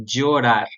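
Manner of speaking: chorar said djo-rar with a D plus J at the start. Shown as a demonstration. Teacher showing students the chosen ll sound. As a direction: open chorar with dj. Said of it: "um D e um J".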